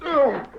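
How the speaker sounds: short hit grunt; pained grunt. Acted. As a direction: grunt from a punch. Punched in a fistfight.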